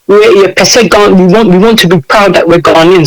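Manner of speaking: usual speaking voice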